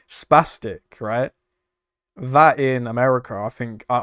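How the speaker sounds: usual speaking voice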